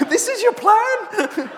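very high, slow, laughing disbelief; incredulous laughing. Retold or imitated. Colleague hears they'll pray over the screen, incredulous laughing shriek.

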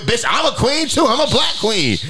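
high, sing-song sass; mocking Meghan claiming she is a queen too; sassy mock retort. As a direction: toss it off like a diva.

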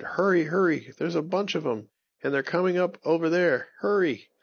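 high, urgent hurry-up call; urgent warning. Acted. Ollie warning friends the witches are coming, high urgent call. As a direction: rush them, sound the alarm. Owl rushes back warning.

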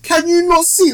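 exasperated. Retold or imitated. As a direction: yell at the screen in disbelief. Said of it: "I'm thinking".

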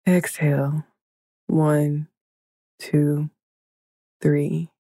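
ordinary talk, nothing marked

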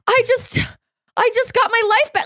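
very high, halting voice; high-pitched overwhelmed squeak; recovering from fright. Retold or imitated. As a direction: act out getting over a scare. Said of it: "I was, like, recovering".

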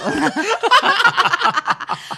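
loud, high peal of laughter; real helpless cackling; big laugh. Real, not acted. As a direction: burst out laughing at the joke. Laughs at own drinking joke.

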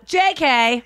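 a real squeal of laughter after a cheeky jk; shrieking laugh. Real, not acted. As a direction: yell the joke and crack up. Joking shout then laughing.

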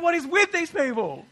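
incredulous laughing complaint; exasperated outburst. Retold or imitated. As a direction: throw up your hands at them. Exasperated at endless reps.

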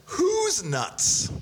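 high dramatic. Acted. Host repeats question dramatically.

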